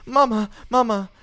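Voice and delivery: high, loud scared call for mamma; frightened cry. Acted. Child calling her mother as the wolf lunges, terrified. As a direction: scream for mother in fear. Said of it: "she put down her head crying".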